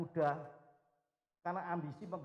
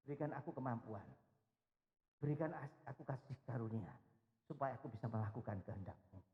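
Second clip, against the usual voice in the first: softer, lower humble plea; humble prayer; 5 semitones lower, 6 dB quieter than usual. Retold or imitated. A humble believer asking God for grace, softly. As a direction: pray it meekly. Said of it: "tetap dengan rendah hati".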